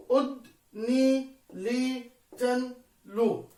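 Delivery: slow, chopped into separate syllables. Shown as a demonstration. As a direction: break the words into metric syllables. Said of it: "أو متحرك مع ساكن مثل عل".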